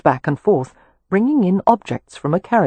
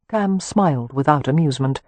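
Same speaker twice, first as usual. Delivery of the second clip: plain reading voice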